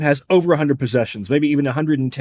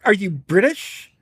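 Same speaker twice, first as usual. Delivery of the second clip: ordinary talk, nothing marked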